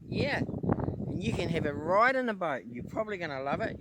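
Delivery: high, loud, sing-song pet talk. Real, not acted. Owner coaxes his dog to enjoy the boat, high sing-song pet talk.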